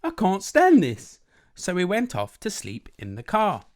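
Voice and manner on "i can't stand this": high, loud, drawn-out complaint; acted exasperated cry; exasperated bear. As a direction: wail slowly at the end of your patience. Mister Bear fed up with noise.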